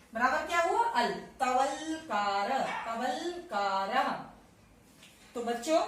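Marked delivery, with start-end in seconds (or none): 1.4-4.1 Talavakar drawn out syllable by syllable, very slow; teacher carefully sounding out तवलकारः; slow demo. Shown as a demonstration. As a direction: spell out तवलकारः slowly for the class. Teacher spells out a word.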